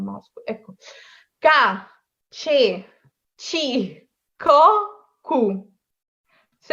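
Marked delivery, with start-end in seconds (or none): 1.4-5.4 isolated syllables "কা, ছে, ছি, ক, কু", paused between each; hard k, but ch before e and i; pronunciation demo. Shown as a demonstration. Teacher reading C syllables for learners, pronunciation demo. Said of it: "আপনাদেরকে পড়ে শুনে এখানে কিভাবে এটা উচ্চারণ করা হবে".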